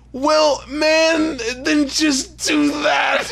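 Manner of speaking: high, drawn-out exasperated retort; mock exasperation. Telling a musician to just do that, mock-exasperated. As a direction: raise the pitch and stretch each word.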